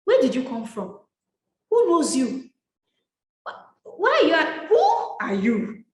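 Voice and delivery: put-on a touch of Nigerian accent, affronted questioning; Nigerian girl accent. Replying to a stranger's DM, a hint of an indignant Nigerian accent. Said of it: "My inner Nigerian girl came out".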